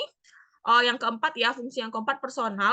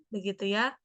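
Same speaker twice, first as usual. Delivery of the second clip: ordinary talk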